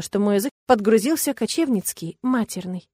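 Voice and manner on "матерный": ordinary delivery, nothing marked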